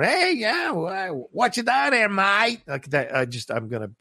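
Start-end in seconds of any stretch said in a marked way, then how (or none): 0.0-2.6 very high, hammed-up Australian mate; mock a broad Australian accent, pitched way up; overt Australian accent. Co-host mocking accents he can't stand, overt Australian. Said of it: "kinda like the more".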